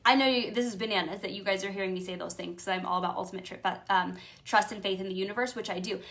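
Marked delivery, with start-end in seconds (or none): none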